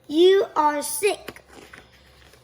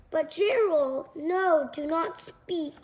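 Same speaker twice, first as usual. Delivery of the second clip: usual speaking voice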